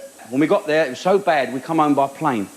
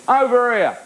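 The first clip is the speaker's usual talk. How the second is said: heckler; 5 semitones higher than usual. Retold or imitated. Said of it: "He said".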